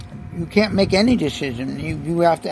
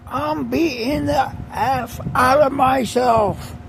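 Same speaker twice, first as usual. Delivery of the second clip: higher, slow, drawn-out comic line; Jim Carrey manic; 6 semitones higher, slower than usual. Retold or imitated. Retelling Liar Liar bathroom scene, mock manic comic voice. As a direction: ham up a gleeful self-beating movie character. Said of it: "Jim Carrey's, he's banging his head".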